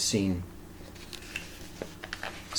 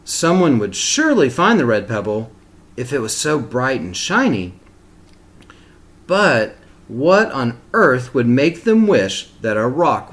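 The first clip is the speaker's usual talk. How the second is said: put-on reading a picture book aloud to children; steady, expressive storybook reading; story narration; 6 dB louder than usual. A narrator's reading.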